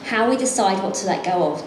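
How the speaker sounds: ordinary delivery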